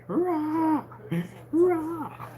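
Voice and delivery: very high, drawn hurrah; playful high cheer. Pretend. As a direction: squeal out a mock cheer.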